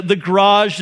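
ordinary talk

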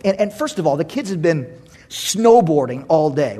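normal talking voice

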